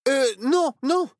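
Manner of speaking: put-on a small boy talking himself into courage; nervous Nao. A narrator's reading. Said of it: "se rassura Nao".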